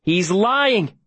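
high-pitched, urgent protest; urgent insistence. A narrator's reading. Todd trying to win Davy from his father, urgent.

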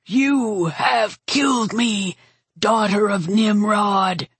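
slow, labored last words of a great beast; dying dragon's whisper. A narrator's reading. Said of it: "the dragon whispered".

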